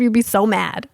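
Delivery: normal talking voice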